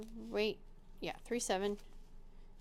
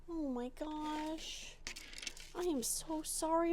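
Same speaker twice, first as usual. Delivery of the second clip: slow, tender, far little sigh; drawn-out soft coo to the cat; 4 semitones higher, 4 dB quieter than usual. Real, not acted. Apologising to the cat.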